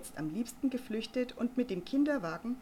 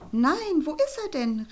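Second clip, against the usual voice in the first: loud, high delighted cry; calling out; 7 semitones higher, 8 dB louder than usual. Acted. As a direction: cry out over the pram. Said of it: "rief Frau Kloß". (laut und hoch gerufen)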